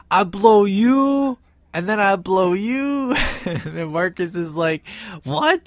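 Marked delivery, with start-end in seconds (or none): 0.1-3.1 high, loud, drawn-out broken English. Retold or imitated. Clerk threatening with his gun, mock broken English. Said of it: "he's got broken English". 5.2-5.6 high-pitched, imitated indignant surprise; mock disbelief. Marcus answering the clerk's odd threat, mock disbelief.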